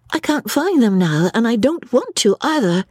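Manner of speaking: put-on high, protesting young lady; stubborn young woman. A narrator's reading. Lucy stubbornly refusing to leave.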